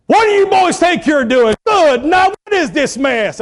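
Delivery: high, loud scolding father; stern dad scolding. Retold or imitated. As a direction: catch the boys messing up the barn. Father voiced scolding in story.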